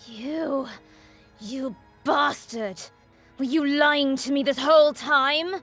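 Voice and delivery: loud, slow betrayed outburst; betrayed fury. Acted. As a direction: spit it out, betrayed. Betrayed princess confronts player.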